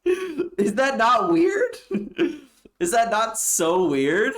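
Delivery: high, slow, breaking into laughter; can't get the words out for laughing; real laughing question; laughing disbelief. Real, not acted.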